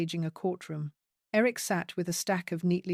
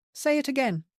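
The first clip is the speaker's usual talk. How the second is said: sharp, higher demand; 7 semitones higher, 4 dB louder than usual. Acted. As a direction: insist hard, raised voice. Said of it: "Sienna demanded".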